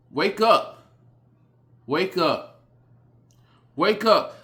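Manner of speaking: high and loud, clipped falls; loud wake-up call. Real, not acted. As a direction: shake them awake with your voice. Calling the audience to wake up.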